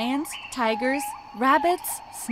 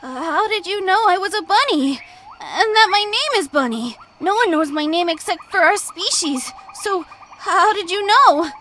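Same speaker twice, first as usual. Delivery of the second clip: a touch of fear, higher and louder; fearful; 7 semitones higher, 8 dB louder than usual. A narrator's reading. Bunny nervously questioning Ostrich, lightly fearful. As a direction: ask nervously, a little scared. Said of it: "asked to her in fear".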